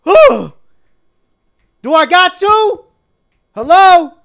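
high, loud terrified shouts; panicked screaming. Real, not acted. Gamer attacked in the dark, screaming in terror. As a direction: shriek and beg not to be attacked. Monster appears.

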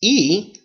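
E said as a drawn-out "ee"; Spanish vowel I. Shown as a demonstration. Teacher demonstrating vowels to learners, Spanish "ee" sound. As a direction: say E as the sound Spanish gives the letter I. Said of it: "I will always sound E".

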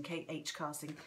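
ordinary talk

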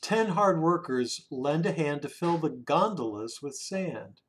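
ordinary delivery

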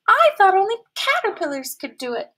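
sad. A narrator's reading. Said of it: "she said sadly".

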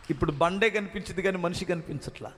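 ordinary talk

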